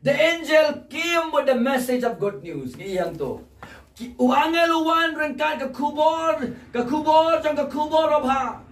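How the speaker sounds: high, loud, ringing proclamation; full-force preaching. Real, not acted. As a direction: cry out the good news.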